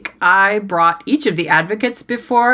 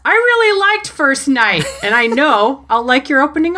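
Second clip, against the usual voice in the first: much higher, delighted praise; gushing; 7 semitones higher than usual. Real, not acted. Host praising Claire's story, high gushing. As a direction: gush at her.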